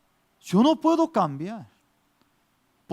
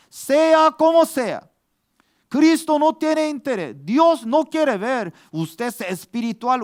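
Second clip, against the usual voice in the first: higher, louder preaching voice, pressing each line; fervent preaching; 5 semitones higher, 5 dB louder than usual. Acted. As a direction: preach it out to the congregation with rising fervor. Preaching at full force.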